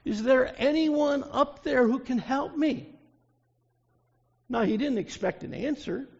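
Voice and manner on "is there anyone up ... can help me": loud, high desperate call upward; crying out for help. Acted. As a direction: shout up to the heavens for help. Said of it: "he cried out".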